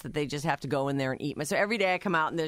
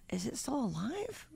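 soft, slow near-whisper; tentative whispered question, drawn out; hushed wondering; 6 semitones higher, 10 dB quieter, slower than usual. Retold or imitated. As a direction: ask it under your breath, slowly. Acts out checking a motionless fish.

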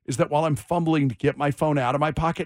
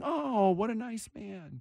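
put-on soft, slow, higher kid voice; mock-sweet cooing; 5 semitones higher, 9 dB quieter, slower than usual. Half the kids approve of the neighbor, mock-sweet cooing. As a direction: imitate won-over kids cooing gently.

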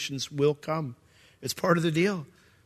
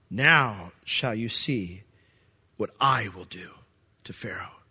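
slow, lower, fading to a whisper; hushed solemn; 6 semitones lower, slower than usual. Acted. God promising Moses, slow hushed fade to whisper. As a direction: let it sink to a whisper.

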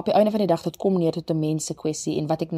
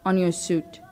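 spoken word poem; 3 semitones higher than usual. Acted. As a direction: let each line hang in the silence. Returns to poem over music.

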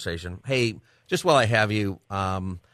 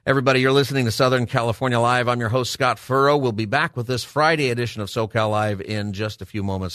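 louder, higher, brisk host read; radio announcer sign-off; 4 semitones higher, 4 dB louder than usual. Real, not acted. Host telling listeners he'll be back, upbeat announcer delivery. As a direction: punch out the show ident before the break.

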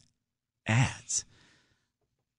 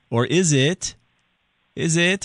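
higher, hanging unfinished question; leading prompt; 6 semitones higher, 3 dB louder, slower than usual. Real, not acted. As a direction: dangle the question for them to finish. Prompting caller to finish question.

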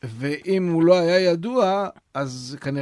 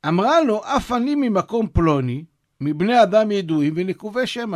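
reading the source text aloud; 5 semitones higher, 5 dB louder than usual. Acted. Reads the source story aloud.

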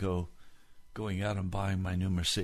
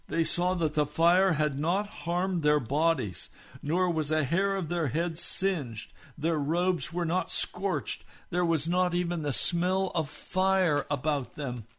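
slightly higher, slow emphasis on wonder; story narration; 7 semitones higher, 4 dB louder than usual. A narrator's reading. Pastor narrating the miracle to the church, emphatic. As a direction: read the miracle with awe.

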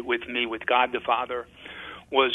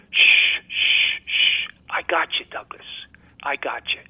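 God the Father. Retold or imitated. God holding the priest in his fears, soothing hush. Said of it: "God the Father holding me, saying".

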